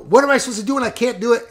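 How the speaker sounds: fast, high mock outburst; imitated yelling. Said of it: "he starts yelling at me".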